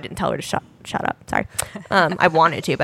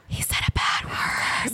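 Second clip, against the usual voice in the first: gasping, slow whispered tattle; a little girl's stage whisper about a bad word; shocked whisper; 9 dB quieter, slower than usual. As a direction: whisper it like a child scandalized. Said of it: "Anya's like".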